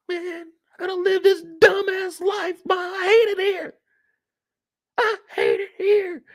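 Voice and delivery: put-on very high, whiny teen tantrum; flipping out. Kanan telling his mom he hates it here, high tearful tantrum. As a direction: wail and complain like a distraught kid. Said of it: "Kanan is just flipping out".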